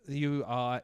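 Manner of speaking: ordinary delivery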